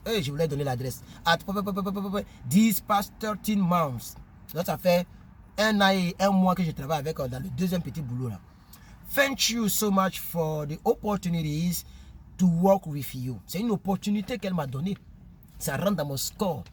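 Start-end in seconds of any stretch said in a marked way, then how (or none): 1.4-2.3 quick popping syllables as filler; rapid "p-p-p" mumble. Real, not acted. Skips the address in the letter. 2.5-4.2 slow letter reading in accented English; strange French-African English accent. Real, not acted. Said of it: "mon accent est trop bizarre". 9.1-13.4 slow letter reading in accented English; strange French-African English accent. Real, not acted. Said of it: "mon accent est trop bizarre".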